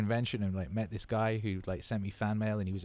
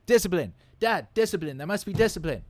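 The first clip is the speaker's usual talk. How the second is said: put-on much higher and louder, a little boy barking orders; kid shouting catchphrases; 10 semitones higher, 7 dB louder than usual. Son to dad, shouting Jocko catchphrases loudly and high. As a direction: shout it like a six-year-old drill sergeant. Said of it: "he's running around shouting".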